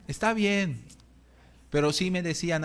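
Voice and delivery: ordinary talk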